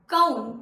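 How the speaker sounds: कौन with one open औ, loud; correct कौन. Shown as a demonstration. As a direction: stress the pure औ in कौन. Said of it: "तो इसलिए हम इसे बोलेंगे कौन".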